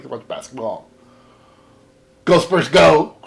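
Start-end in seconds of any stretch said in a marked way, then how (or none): 2.2-3.2 loud chant for the team; fan cheer. Real, not acted. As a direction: cheer like in the stands.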